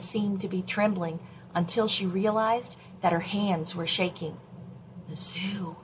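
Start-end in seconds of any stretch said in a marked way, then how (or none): 5.1-5.8 soft hushed words; whispered. Acted. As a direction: breathe it out under your breath. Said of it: "she whispered".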